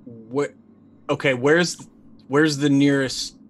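normal talking voice

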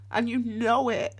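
high, tearful, choked confession; real tears, urging them gently; crying through the words, voice breaking high. Real, not acted. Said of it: "me crying".

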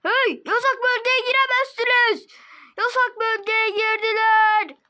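high, loud shout of a guard; stern guard shout. Acted. Guard yelling at girls in the restricted area, loud and high. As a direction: bark the warning like security.

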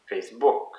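"Facebook" with stress moved onto "book"; French pronunciation. Shown as a demonstration. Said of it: "in French it's Facebook".

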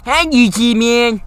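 high, loud hype greeting; excited greeting. Real, not acted. Gamer hyping up his YouTube audience, loud and high. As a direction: shout hello to the viewers.

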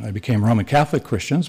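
usual speaking voice

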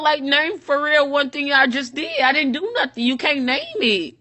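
ordinary delivery

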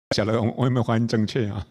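fast, loud words through real laughter; giggling self-mocking question; laughing aloud. Real, not acted. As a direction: burst out laughing as you ask. Laughing at his own joke.

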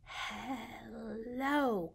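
very soft, hushed hello; quiet hello. Shown as a demonstration. Leading babies in saying hello very quietly. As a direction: say it as softly as you can. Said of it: "as quietly as we can".

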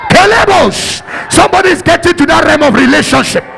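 loud, fired-up sermon delivery; thundering church preacher; full-force preaching. Acted. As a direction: preach it out at the top of your voice.

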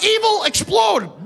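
high, loud shrieking woman; panicked woman. Retold or imitated. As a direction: shriek it like a terrified passenger.

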